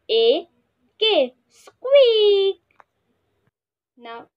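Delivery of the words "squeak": high squeak for the class; squeaky mouse voice. Shown as a demonstration. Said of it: "sound that mouse make is".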